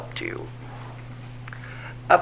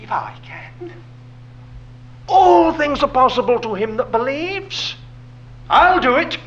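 rising assurance; 7 semitones higher, 4 dB louder than usual. Retold or imitated. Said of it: "the Lord was saying".

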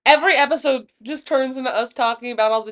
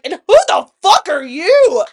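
loud, raised, emphatic confrontation; retold furious challenge; 6 semitones higher, 11 dB louder than usual. Storyteller re-enacting her challenge to homeboy, angrily loud. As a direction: turn on him in acted outrage. Retold turning on man who intervenes.

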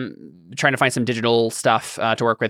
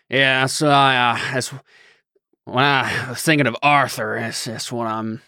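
badly attempted Arthur voice. Shown as a demonstration. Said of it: "that was a terrible Arthur".

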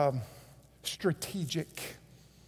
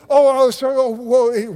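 loud, higher, mock-flustered apology; flustered TV host; 3 semitones higher, 7 dB louder than usual. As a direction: blurt out a hammed-up, startled apology. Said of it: "the lady did".

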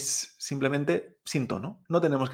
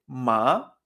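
"Ma" gliding upward; second tone; 2 semitones lower than usual. Shown as a demonstration. Teacher reviewing the word for hemp, "Ma" rising. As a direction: lift "Ma" like a question. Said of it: "segundo tono".

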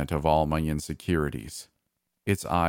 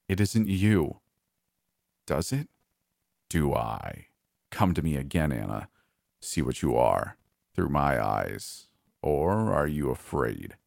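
mirror monster voice; 3 semitones lower than usual. Acted. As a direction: drag the words out low, like something hungry behind the mirror. Mirror monster taunts narrator.

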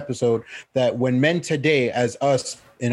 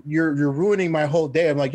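ordinary delivery

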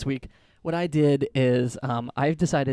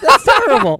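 loud high squeal of disgust; shocked squeal; 13 semitones higher, 11 dB louder than usual. Real, not acted. Reacting to cooking with grease.

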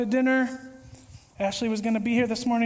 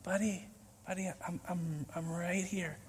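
much softer, low, calm reply; gentle reassurance; 5 semitones lower, 12 dB quieter than usual. Retold or imitated. Jesus gently answering the panicking believer, soft and calm. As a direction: soothe him like a patient friend. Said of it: "And he's like".

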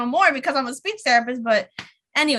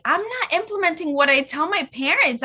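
freaking out; 7 semitones higher than usual. Retold or imitated. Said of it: "I'm, like, freaking out".

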